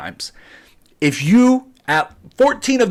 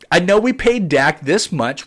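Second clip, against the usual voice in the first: ordinary talk, nothing marked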